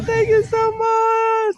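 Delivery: high, slow, drawn-out gratitude; elated thanks. Real, not acted. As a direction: sing out thanks in sheer delight. Thanking viewers after goal.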